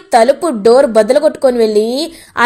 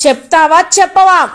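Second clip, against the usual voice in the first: threatening; 5 semitones higher, 3 dB louder than usual. A narrator's reading. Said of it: "బెదిరిస్తూ ఉంటాడు".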